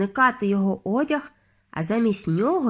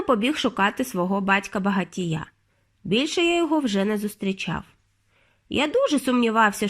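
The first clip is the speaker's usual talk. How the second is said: plain reading voice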